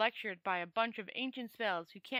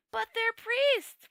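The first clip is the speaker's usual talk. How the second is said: high, loud, drawn-out slow wail; 13 semitones higher, 9 dB louder, much slower than usual. Acted. Two Flower protesting to Rincewind, a high slow wail. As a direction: plead it out in dismay, much higher. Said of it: "wailed Two Flower".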